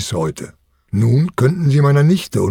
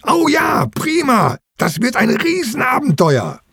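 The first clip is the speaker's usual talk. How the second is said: put-on very high-pitched jubilant children; squealing with excitement at a big adventure; cheering; 12 semitones higher than usual. A narrator's reading. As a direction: cheer like overjoyed kids. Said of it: "Die Kinder jubeln".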